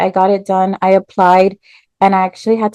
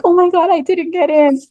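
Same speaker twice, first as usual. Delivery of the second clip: much higher wail of disappointment; dramatic despair; 10 semitones higher than usual. Retold or imitated. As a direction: assume the worst, melodramatic. Assuming rejection.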